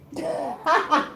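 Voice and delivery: high, amused giggle. Real, not acted. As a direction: giggle at the scene. Laughs at the choked dog.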